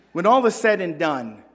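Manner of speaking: ordinary delivery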